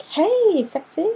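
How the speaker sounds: high, drawn-out flirty greeting; mock-flirt with a high lilt; playful flirting. Friend greeting her close friend, playfully flirtatious.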